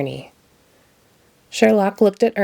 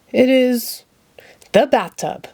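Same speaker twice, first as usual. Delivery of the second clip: a hint of pompous Sherlock Hemlock; boastful detective announcing himself; grand self-introduction, lightly done; lightly voiced grand detective; 5 semitones higher, slower than usual. A narrator's reading. Sherlock guessing.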